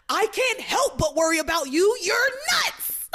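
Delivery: high, loud fed-up yell; retold yell. Luis yelling at Teresa that she's nuts, loud and high. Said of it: "He yells".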